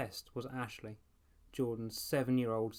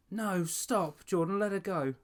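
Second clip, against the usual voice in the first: desperate plea; 8 semitones higher, 7 dB louder than usual. A narrator's reading. Girl pleads, doll friend seized.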